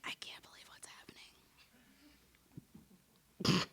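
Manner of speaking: hushed whisper of disbelief; whispering to herself in the braid shop. Retold or imitated. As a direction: breathe it out in a stunned whisper. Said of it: "I was like".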